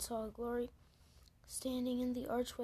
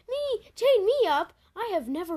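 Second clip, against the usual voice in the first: much higher and louder, squeaky shock; stunned disbelief; 9 semitones higher, 13 dB louder than usual. Pretend. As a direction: squeal as if you can't believe it. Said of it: "I'd be like".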